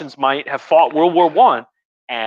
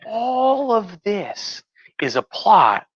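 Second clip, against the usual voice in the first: lightly voiced higher slow conspiratorial wife; conspiracy-minded wife; 8 semitones higher, much slower than usual. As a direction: spin it slowly like a paranoid whisperer of plots. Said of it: "she says".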